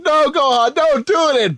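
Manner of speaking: high, urgent cry to stop; pleading shout. Retold or imitated. Supreme Kai shouting at Gohan not to fight, high and urgent. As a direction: yell the warning in panic. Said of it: "Supreme Kai's like".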